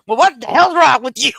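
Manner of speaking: loud incredulous husband; hammed-up outrage; husband's indignant retort. Wife retelling her husband's reply, loud and indignant. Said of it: "he's like".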